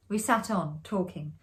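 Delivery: plain reading voice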